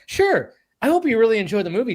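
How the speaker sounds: high, quick, eager-to-please reply; voice assistant. Retold or imitated. Alexa answering a reminder request, high friendly imitation. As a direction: imitate an overly friendly AI helper. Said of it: "Hey, Alexa, can you remind me".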